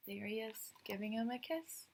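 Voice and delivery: plain reading voice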